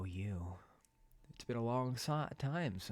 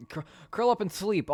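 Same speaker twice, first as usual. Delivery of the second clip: mock urging; 9 semitones higher, 8 dB louder than usual. Repeats suggestion louder, higher.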